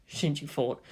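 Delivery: ordinary delivery, nothing marked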